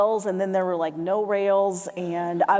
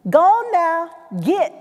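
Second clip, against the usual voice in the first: raised, louder Southern command, imitated; fearless Southern grandmother; 6 semitones higher, 4 dB louder, much slower than usual. Grandmother to the moose, fearless command. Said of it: "with no fear whatsoever".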